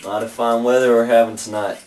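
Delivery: friendly American Southern bartender voice, lightly put on; mock Southern accent. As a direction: greet a stranger like an old-time saloon keeper in a Southern accent. Cowboy bartender role.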